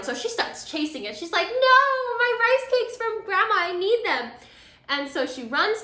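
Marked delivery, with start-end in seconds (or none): 1.5-4.3 high, louder wail of dismay; dismayed cry. Retold or imitated. Girl chasing grandma's rolling rice cakes, high dismayed cry. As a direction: cry out as the food rolls off.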